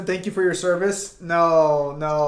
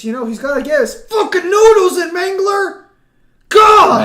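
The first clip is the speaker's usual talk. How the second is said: loud, higher exasperated shout; 11 semitones higher, 11 dB louder than usual. Real, not acted. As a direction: shout it up high in disbelief. Mocking friend making noodles.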